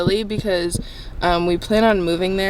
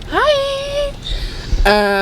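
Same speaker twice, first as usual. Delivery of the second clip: cheerful greeting. Real, not acted. Friend greets camera.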